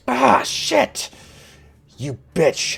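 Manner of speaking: high, loud cry of pain turning to fury; pained angry bandit. Acted. Wounded bandit threatens the archer who shot him, pained and angry. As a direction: roar threats at whoever just hurt you.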